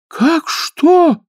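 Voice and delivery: very high, shocked gasp; gasping surprise. Acted. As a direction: gasp in dismay. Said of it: "хором ахнули русалки". (ахнули)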